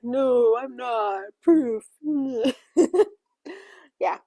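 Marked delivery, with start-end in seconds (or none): 0.0-3.1 louder, defiant denial with a pushy Proof; father mockingly denying paternity, loudly; mock defiant denial. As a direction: throw back the denial like a dare. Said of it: "and he's like".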